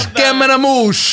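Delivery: high, slow wiseguy catchphrase; hammed-up Brooklyn tough guy.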